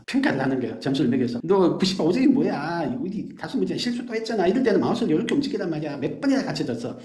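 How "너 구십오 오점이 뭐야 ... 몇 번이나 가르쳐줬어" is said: mock nagging. 게임 가르치며 잔소리하는 어른 흉내.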